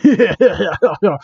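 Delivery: put-on repeating cartoon laugh; character's canonical laugh. Shown as a demonstration. Reader demonstrates how the character laughs, mock cartoon laugh. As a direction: laugh in a silly chain of identical syllables. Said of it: "That's how Sonic Daiichi laughs, canonically".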